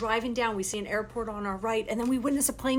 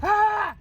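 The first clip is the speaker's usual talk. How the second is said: short, high, loud yell; high-pitched burst of "Ah!"; imitated scream; 12 semitones higher, 6 dB louder than usual. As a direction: re-enact his sudden scream.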